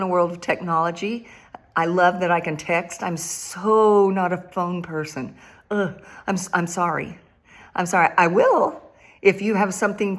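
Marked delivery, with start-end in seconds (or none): none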